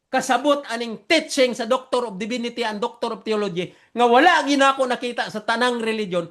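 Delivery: normal talking voice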